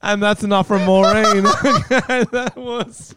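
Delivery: high, breaking up with laughter; real laughing. Real, not acted. Host cutting off the joke caller, real high laughing. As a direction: try to move on through laughter.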